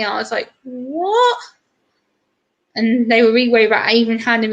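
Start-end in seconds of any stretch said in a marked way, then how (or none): 0.6-1.4 high, rising shocked what; surprised. Retold or imitated. Daughter reacting to mum's hospital story, surprised what. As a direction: disbelief at what mum told her. Hearing she looked Chinese.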